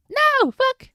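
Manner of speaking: loud high shriek of annoyance; frustrated shout. Real, not acted. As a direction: yell it, fed up. Frustrated shout at last page.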